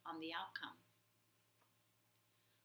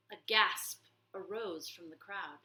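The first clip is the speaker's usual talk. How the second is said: usual speaking voice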